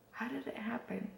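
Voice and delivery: near-whispered self-question; musing to oneself. Real, not acted. Vlogger trying to remember how it happened, musing quietly to herself.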